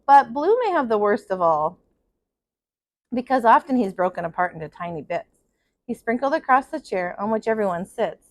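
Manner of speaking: put-on steady storyteller reading to children; story narration. A narrator's reading. Teacher reading a picture book to her class, in a gentle storytelling voice. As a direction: read it out like circle time for kids.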